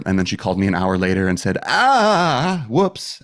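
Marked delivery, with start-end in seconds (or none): 1.6-3.2 high, loud, stretched-out oops; hammed-up awkward retraction; mock sheepish doctor. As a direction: mimic the doctor owning up to a blunder. Mocks doctor retracting the diagnosis.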